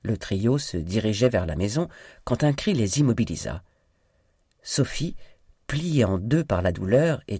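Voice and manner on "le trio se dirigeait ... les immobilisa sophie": ordinary delivery, nothing marked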